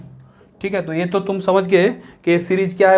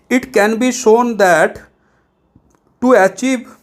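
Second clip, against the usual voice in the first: very slow read-aloud; reading from the book; 3 semitones higher, much slower than usual. Shown as a demonstration. Teacher to students, reading the textbook example very slowly. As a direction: spell the sentence out at a crawl. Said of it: "इसका एक practical example दे रहा है".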